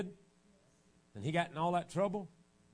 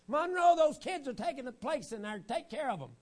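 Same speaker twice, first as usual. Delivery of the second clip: complaining wife; 11 semitones higher, 5 dB louder than usual. Retold or imitated. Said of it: "she's the one that said".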